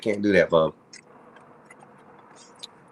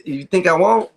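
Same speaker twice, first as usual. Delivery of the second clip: louder, higher dare; high defiant dare; 9 semitones higher, 3 dB louder than usual. Acted. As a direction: call his bluff. Daring the boss.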